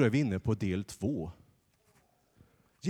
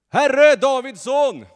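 loud, high, drawn-out cry; shouting beggar; 15 dB louder, slower than usual. Acted. Blind beggar shouting to Jesus, loud and high. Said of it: "nu skrek jag".

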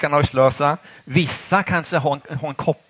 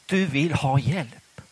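ordinary talk

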